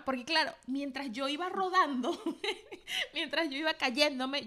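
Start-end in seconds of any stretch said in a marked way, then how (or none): 2.2-3.1 risa aguda. Real, not acted. Laugh while telling the fall.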